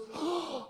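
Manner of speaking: sudden mock gasp of realization; gasp of insight. Miss Jones suddenly gets an insight, imitated gasp. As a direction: gasp as if struck by an idea. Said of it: "like she had gotten some insight".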